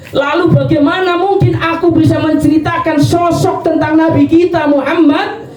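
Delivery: high, fervent preaching; impassioned climax. Acted. Ali asking the Badui how he could ever describe the Prophet, fervent. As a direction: build to a passionate peak. Ali's climactic rhetorical question.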